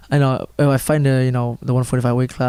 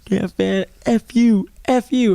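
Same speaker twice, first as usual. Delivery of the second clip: mock taunt; 8 semitones higher, slower than usual. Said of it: "Savak looks down at me".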